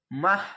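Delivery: "মাহ" closed with an audible h; pausal h ending. Shown as a demonstration. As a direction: stop on a breathed h in "মাহ". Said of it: "যদি থামেন তাহলে". ("মাহ" হা দিয়ে থামা)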